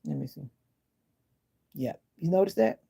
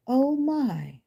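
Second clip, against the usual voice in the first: shocked; 8 semitones higher than usual. Real, not acted.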